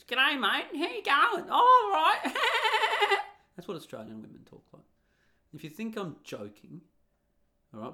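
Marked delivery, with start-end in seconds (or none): 0.0-2.2 put-on very high, loud screechy ocker accent; over-the-top Australian twang, pitched way up and blaring; mock Australian woman. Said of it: "literally talk like this". 2.2-3.3 loud, very high cackle in the Aussie woman voice; shrill mocking laugh shouted high; shrieking laugh. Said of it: "literally talk like this".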